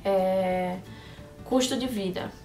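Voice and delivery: ordinary delivery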